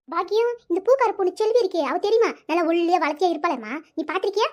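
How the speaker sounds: ordinary talk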